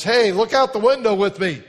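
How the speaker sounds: put-on high, loud eager child voice; chirpy little girl. Girl asking Joe to look out the window, high and eager. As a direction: invite him brightly like a chatty kid.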